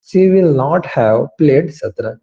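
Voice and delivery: drawn-out, word by word; slow model sentence. Shown as a demonstration. Teacher modelling the full negative slowly. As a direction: dictate it carefully. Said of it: "तो कैसे बोलेंगे इस sentence को?".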